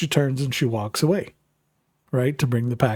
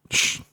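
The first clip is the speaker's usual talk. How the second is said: short, firm shh; hushing shush. Retold or imitated. Husband cuts off wife's justifying, hushing shush. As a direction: shush someone mid-excuse.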